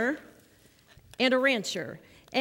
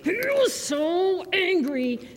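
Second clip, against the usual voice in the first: higher, louder, slow hammed-up anger; mock angry; 8 semitones higher, 4 dB louder, slower than usual. Shown as a demonstration. Leader acting out Cain's anger for the children, hammed-up angry. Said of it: "Can I see your angry face?".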